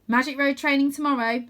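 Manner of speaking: loud, commanding briefing; brisk announcement. A narrator's reading. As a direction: announce the plan to the whole camp. Stella gives the group orders.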